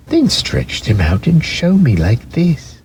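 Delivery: high, teasing rabbit voice; doubtful wild rabbit. A narrator's reading. Wild rabbit doubting the toy rabbit, high and teasing. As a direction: needle him, a little sceptical.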